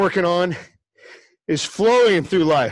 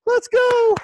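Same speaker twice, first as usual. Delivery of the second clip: "Let's go" sung out high, stretched on "go"; hype call. Real, not acted. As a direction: lift "Let's go" way up to rally the team. Repeated call, pitched way up.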